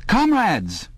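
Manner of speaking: high eager telescreen announcer; eager youthful announcer. A narrator's reading. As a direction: call out like a keen state broadcaster. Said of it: "cried an eager, youthful voice".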